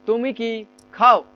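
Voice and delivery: slow question, খাও set apart and stressed; pause, then hit খাও; drawn-out model with weight on খাও. Shown as a demonstration. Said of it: "এটা জোর দিয়ে বলেন".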